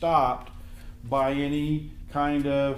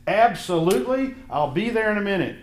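higher and louder, jumping at the offer; eager yes; 6 semitones higher, 5 dB louder than usual. Retold or imitated. Husband grabbing a friend's golf invite, eager and loud. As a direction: snap up the tee time gladly. Golfer accepts the tee time.

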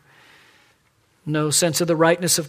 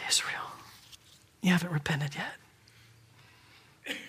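soft, sighing disappointment; sigh, then say it quietly and heavily; weary disappointment; 10 dB quieter, much slower than usual. Acted. God to unrepentant Israel, weary and disappointed.

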